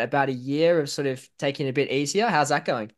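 ordinary delivery

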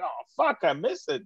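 high-pitched mock regret; mock disappointment. Comedian retells a George Lopez joke, mock disappointment. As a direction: lament it like you missed the fun.

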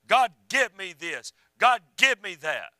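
imitating pounding, insistent prayer; slow, loud mock demand, each word hammered. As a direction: act out someone ordering God around. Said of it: "They were beating and a banging and a pounding".

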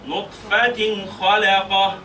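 نطفة خلقة drawn out slowly with clear nunation before خلقة; slow careful recitation; slow tajweed recitation. Shown as a demonstration. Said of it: "بالتنوين".

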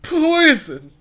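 high, loud cry of poison; loud dramatic yell. Acted. Catchphrase after taking shot.

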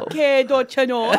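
lightly put-on Canadian accent. Guest jokes along with laughing hosts, in a light Canadian accent. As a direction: lean into folksy Canadian vowels. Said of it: "That's my Canadian accent".

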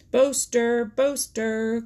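high, loud taunting call; jeering cry. A narrator's reading. Peter Rabbit mocking Reddy Fox, crying out jeeringly. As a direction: call the name out like a jeer. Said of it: "cried Peter Rabbit".

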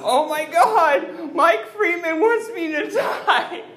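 loud, high laughing outburst. Real, not acted. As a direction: laugh it out in disbelief. Laughing at host's heckle.

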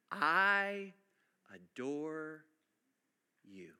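almost whisper it, lovingly; hushed tender. Acted. Husbands texting wives message.

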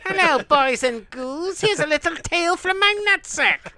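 high, slow cackling ghoul voice; Crypt Keeper voice. Retold or imitated. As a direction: croon it like a cackling ghoul host. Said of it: "He's looking at the Crypt Keeper".

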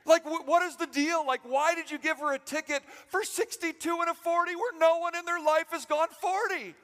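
high, loud, indignant comic tirade; mock outraged rant. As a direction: rant in hammed-up outrage on your wife's behalf. Mock rant over wife's ticket.

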